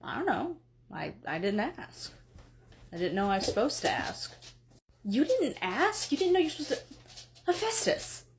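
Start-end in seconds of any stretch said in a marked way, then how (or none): 5.0-8.2 incredulous. Acted. Wife scolding, incredulous.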